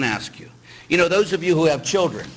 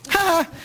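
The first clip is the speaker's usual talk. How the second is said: high laugh. Real, not acted. After saying beat.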